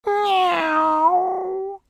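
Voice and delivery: high sustained cat meow. Acted. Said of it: "it said".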